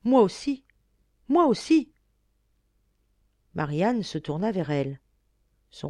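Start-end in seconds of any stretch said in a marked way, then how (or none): 0.0-0.6 the first moi aussi, higher and louder, bursting out; heartfelt cry. A narrator's reading. Sister bursting out her own longing, loud cry. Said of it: "le cri de tout son être". 1.3-1.9 the second moi aussi, pitched much higher and louder, moi stressed; heartfelt cry rising. A narrator's reading. Sister repeating her longing, an even higher cry. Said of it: "le cri de tout son être".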